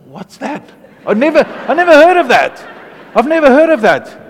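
high, loud and fast, baffled; astonished disbelief. Retold or imitated. To the backslidden guy, loud high astonished disbelief. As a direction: blurt it out like you've never heard the word. Retold shock at unknown word.

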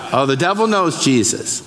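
ordinary talk

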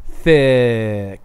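"thick" stretched very long on the vowel; drawn-out THICK. Shown as a demonstration. Host spelling out the slang for co-hosts, THICK drawn out. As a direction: draw out "thick" as if it had seven C's. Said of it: "like, seven C's".

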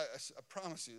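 ordinary talk

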